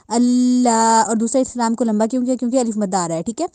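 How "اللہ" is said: اللہ with hard لل and long aa; doubled lam. Shown as a demonstration. Teacher asks how it was read, then models اللہ. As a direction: press and stretch اللہ. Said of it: "تو ہم نے کیسے پڑھا".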